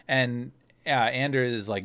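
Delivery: normal talking voice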